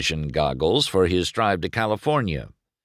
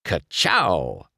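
punchy race car catchphrase. Acted. McQueen admiring his fresh paint, punchy catchphrase. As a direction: fire it off like a signature.

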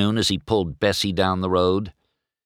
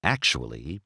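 thinking to herself; 6 semitones lower, 6 dB quieter than usual. Acted. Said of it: "she thought".

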